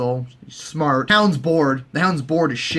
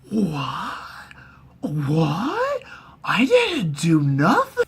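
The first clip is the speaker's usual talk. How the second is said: drawn-out rising whats, put-on voice; feigned innocence; 2 semitones higher, much slower than usual. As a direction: play dumb when accused, stretching the words. Accused Baelish plays innocent.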